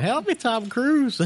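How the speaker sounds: high, slow mock plea for help; fluttering cries for help. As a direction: ham up a Ricky Bobby plea. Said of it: "Ricky Bobby running around out there".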